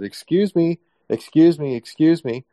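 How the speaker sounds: loud, high, insistent excuse me; calling out to interrupt. Retold or imitated. Guest breaking into a conference presentation, calling out loudly.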